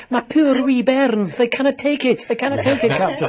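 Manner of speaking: high, loud, hammed-up Scottish engineer; Montgomery Scott. Host goading a guest into a fake accent, hammed-up Scottish engineer. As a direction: wail it in a mock Scottish panic. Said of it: "as Montgomery St-, Scott in Star Trek".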